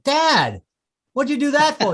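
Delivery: put-on high, loud indignant kid; a kid smacked at the table, high and loud; hurt kid. Kid hit by father's ring.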